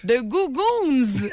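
put-on slow, careful franglais with a French accent; mildly imitated French-accented English; broken English. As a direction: spell it out patiently to the clerk. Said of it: "elle a demandé à une employée".

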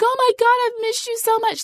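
hammed-up overjoyed greeting; gushing excitement. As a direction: squeal it like a doting dog lover. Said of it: "even though I'm not like".

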